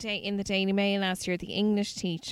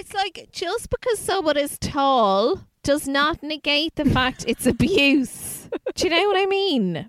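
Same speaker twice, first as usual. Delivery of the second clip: indignant rant; 6 semitones higher, 6 dB louder than usual. Real, not acted. Host rants to co-host about the abuse defence, loud and indignant. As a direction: build to an outraged complaint.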